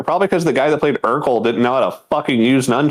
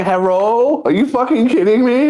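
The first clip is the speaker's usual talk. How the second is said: mock outrage; 9 semitones higher than usual. Voicing an offended viewer to his co-host, high and exasperated. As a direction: throw up your hands, incredulous.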